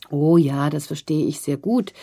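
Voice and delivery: ordinary delivery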